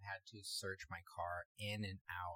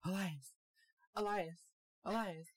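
high, louder pleading calls of a name; imitating a panicked friend on the phone; distraught calling; 8 semitones higher, 3 dB louder than usual. Friend mimics her panicked phone call: mock distraught pleading. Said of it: "she is hella distraught".

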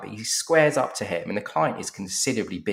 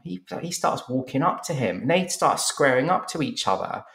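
usual speaking voice

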